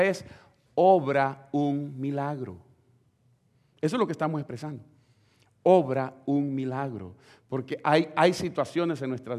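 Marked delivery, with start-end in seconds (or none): 0.7-2.6 pleading prayer. Shown as a demonstration. Preacher voicing the believer's plea to God, slow and earnest. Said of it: "cuando nosotros decimos". 5.6-7.1 pleading prayer. Shown as a demonstration. Preacher voicing the believer's plea to God, slow and earnest. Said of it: "cuando nosotros decimos".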